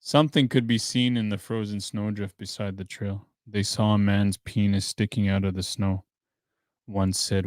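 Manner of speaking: ordinary delivery, nothing marked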